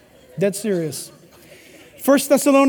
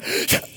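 sharp soft gasp of restraint; tempted gasp; 7 dB quieter than usual. Retold or imitated. Boyfriend resisting temptation, a soft sharp gasp. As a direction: catch the breath, holding back. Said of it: "He'll go".

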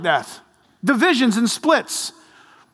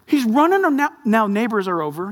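normal talking voice

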